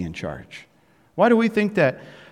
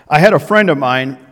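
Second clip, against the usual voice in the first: ordinary delivery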